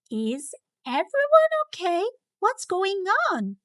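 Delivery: high, worried nurse bursting in; alarmed nurse. A narrator's reading. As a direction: ask it like a startled school nurse.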